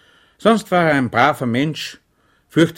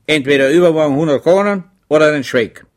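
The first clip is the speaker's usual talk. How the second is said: reckless; 5 semitones higher, 8 dB louder than usual. A narrator's reading. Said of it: "sagte der Feldkurat leichtsinnig".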